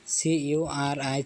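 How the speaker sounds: সি ইউ আর আই, each letter named slowly and apart; teacher slowly spelling out the Malay word for a learner; spelled out letter by letter. Shown as a demonstration. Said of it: "মালয়েশিয়াতে চুরিকে চুরি বলা হয়".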